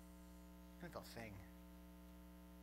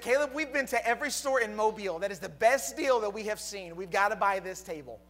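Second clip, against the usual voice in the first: louder, pressing wife voice; insistent wife; 4 semitones higher, 9 dB louder than usual. Retold or imitated. Wife urging the husband to buy the table, insistent. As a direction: push him to buy it.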